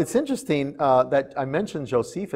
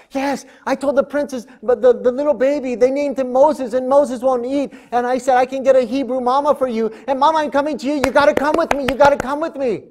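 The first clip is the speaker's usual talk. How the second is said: put-on high, louder, breathless little girl urging; excited girl; 9 semitones higher, 4 dB louder than usual. Miriam begs her mother to come, high and excited. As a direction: hurry mom along.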